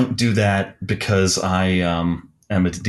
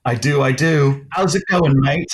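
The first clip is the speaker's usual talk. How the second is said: higher-pitched, hammy British greeting; horrible British accent; 6 semitones higher than usual. Acted. Guest greeting the podcast host, in a horrible British accent. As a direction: greet them in a badly faked British accent. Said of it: "That was my horrible British accent".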